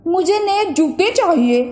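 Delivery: lightly voiced boy making a wish; a boy asks the magic watch; boy's voice. A narrator's reading. Boy wishes aloud for new shoes.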